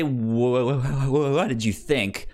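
normal talking voice

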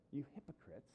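quiet, restrained rebuke; Jesus. Acted. Jesus addresses us as the Pharisees, softly accusing. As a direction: say it softly, straight to the listener. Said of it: "we're the audience that Jesus is talking to".

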